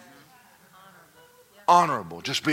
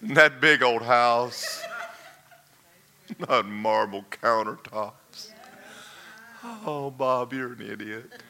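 self-mocking lament; slower than usual.

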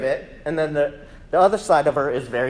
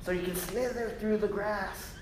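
coaxing voice; 4 semitones higher, 7 dB quieter than usual. Pretend. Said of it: "over here is like".

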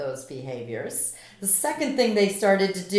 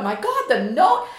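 a louder, laughing "My God"; laughing joy; 4 semitones higher, 5 dB louder than usual. Retold or imitated. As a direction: relive the happy shock through a laugh. Retold joyful realization.

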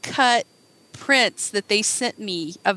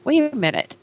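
stammering, puzzled double take; puzzled reader caught off guard; confused; 3 semitones higher than usual. Retold or imitated. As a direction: act out baffled confusion, pitch lifting. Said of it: "I was actually sort of confused".

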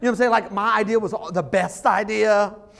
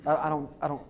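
lower, trailing off softly; hesitant, softening refusal in a retelling; mock reluctance; 5 semitones lower, 8 dB quieter than usual. Imitating reluctant man refusing sharks.

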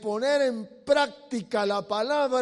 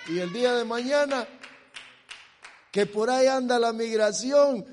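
ordinary talk, nothing marked

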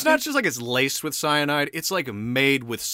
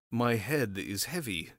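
poisoned man's complaint; 4 semitones lower, 8 dB quieter than usual. Acted. Rasputin to his host after the cyanide cake, subdued complaint. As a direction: complain heavily and quietly, like a poisoned guest.

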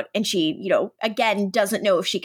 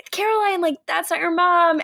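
panicked warning; 8 semitones higher than usual. Retold or imitated. Bonnie warns Caroline, panicked.

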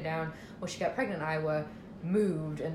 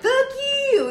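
raised, mock-defiant retort; hammed-up loud backtalk; talking back; 8 dB louder than usual. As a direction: yell it back as an imitated comeback. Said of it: "you get talk back to 'em".

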